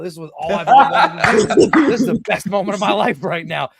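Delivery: high, loud, laughing joy; elated outburst. Retold or imitated. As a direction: gush it like a dream come true. Acting out his elation in ring.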